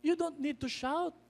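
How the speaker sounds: calm the child down, lightly voiced; a light hint of gentle mother. Mother gently correcting her child. (mahinahong boses ng nanay)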